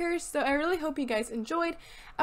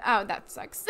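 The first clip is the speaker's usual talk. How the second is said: ordinary talk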